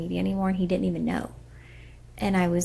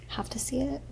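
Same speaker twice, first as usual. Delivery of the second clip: whispered; 6 semitones higher, 6 dB quieter than usual. Real, not acted. Confessing fear, voice drops away.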